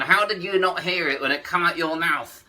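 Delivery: ordinary talk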